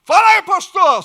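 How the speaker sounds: high, loud call on speakerphone; loud greeting. Retold or imitated. Pastor on speakerphone greeting loudly in the supermarket, loud call. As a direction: shout into the phone. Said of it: "esse aqui é meu pastor".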